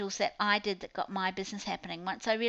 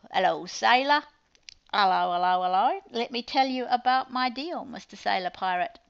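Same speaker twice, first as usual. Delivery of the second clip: loud, hammed-up hello to a sailor; playful sailor greeting; 6 dB louder than usual. Host hailing Mister Sailor Pirate about her deal, hammed-up. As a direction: call out a mock saucy sales greeting.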